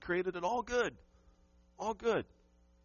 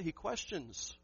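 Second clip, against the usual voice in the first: ordinary talk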